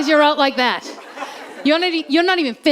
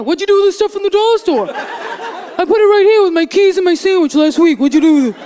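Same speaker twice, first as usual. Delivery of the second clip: loud nagging back-and-forth; accusing husband voice; 6 dB louder than usual. Retold or imitated. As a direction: snap the question back. Husband and wife quarrel.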